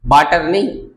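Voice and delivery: ordinary talk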